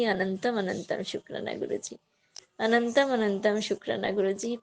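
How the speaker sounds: soft, hushed thanks to the guru; devotional prayer. Real, not acted. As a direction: murmur gratitude like a prayer. (धीमी आवाज़ में भक्तिपूर्ण प्रार्थना)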